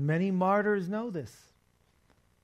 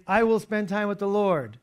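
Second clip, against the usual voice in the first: declared; 5 semitones higher, 7 dB louder than usual. Shown as a demonstration. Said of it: "We can all say".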